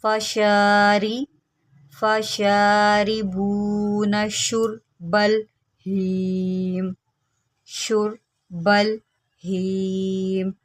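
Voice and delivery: फशारी with the शी held one alif long; lengthened vowel. Shown as a demonstration. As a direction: stretch the vowel after शीन to a full beat. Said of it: "एक अलिफ के बराबर लंबा करना है".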